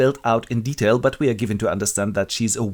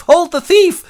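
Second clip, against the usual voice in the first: loud, high call for help; alarmed shout; 10 dB louder, slower than usual. Acted. Robbed gentleman calls after the boy, shouting high. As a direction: raise the alarm down the street.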